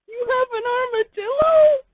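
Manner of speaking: very high, loud crying squeak; high whining voice. Acted. The listener echoing Pimpich in a high, loud crying squeak. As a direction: squeal the word in disbelief.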